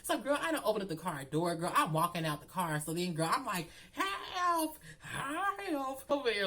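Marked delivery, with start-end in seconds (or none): 3.9-6.5 high-pitched, drawn-out mock cry for help; damsel in distress. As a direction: play a melodramatic damsel calling for rescue. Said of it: "acting like I am a damsel in distress".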